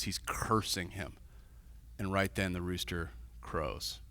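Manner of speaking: low, soft grave sermon tone; somber preaching. Acted. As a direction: sink into quiet sorrow over the failure.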